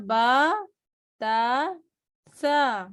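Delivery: با تا سا each drawn out as Arabic letters; Arabic letter sounds. Shown as a demonstration. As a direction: spell them the Arabic way. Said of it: "اسے با تا سا نہیں پڑھنا". (عربی حروف کی طرح)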